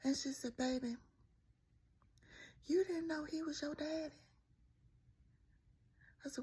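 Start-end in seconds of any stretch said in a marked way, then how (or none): 0.6-4.1 country teacher. Retold or imitated. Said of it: "that's how they talk. We from the country".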